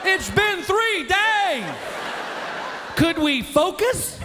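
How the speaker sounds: high, slow drawn-out wail; imitating a hollering wife; mock holler. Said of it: "she'd holler".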